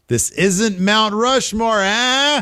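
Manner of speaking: high, held-out promo holler; Big E. Retold or imitated. Big E answering a rival on Raw, high drawn-out holler. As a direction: stretch it high and end on a long "ah". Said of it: "And Big E says".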